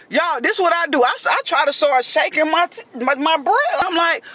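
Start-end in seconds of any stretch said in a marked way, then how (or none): none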